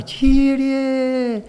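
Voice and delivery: high, strained call in a different timbre; a high, strained, lightly voiced cry of Κύριε; imitated cry to the Lord. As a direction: mimic the wife crying out Κύριε. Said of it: "τόσο δυνατά".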